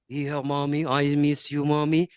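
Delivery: put-on low male voice; grown man's voice. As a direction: imitate a son's deep grown-up voice. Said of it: "suaranya kayak om-om".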